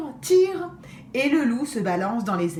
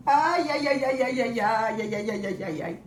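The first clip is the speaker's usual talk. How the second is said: pained cries fading. Acted. The fallen wolf moans in pain, fading. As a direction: groan it out and let it fade. Wolf hurt after falling.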